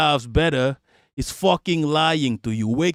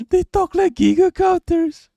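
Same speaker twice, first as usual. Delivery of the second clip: very high-pitched squeaky mockery; silly mocking voice; 14 semitones higher, 4 dB louder than usual. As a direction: jump way up into a silly falsetto jibe. Repeats the jibe in silly voice.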